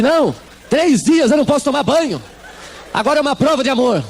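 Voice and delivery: high, earnest mock plea; wife begging her husband, lightly hammed-up; mother's plea. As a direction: plead as if bathing would break love.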